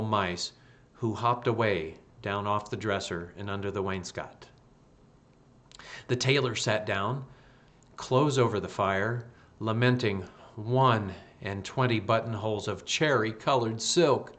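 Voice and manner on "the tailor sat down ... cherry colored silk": ordinary delivery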